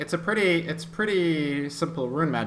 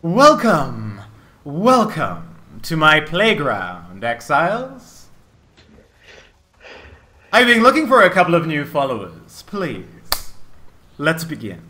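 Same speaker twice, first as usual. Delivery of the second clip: gloating necromancer villain; 5 dB louder, slower than usual. Acted. Necromancer greets the exiles to his playground, gloating and theatrical. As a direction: relish every word like a showman villain. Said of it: "The necromancer himself smiles and he goes".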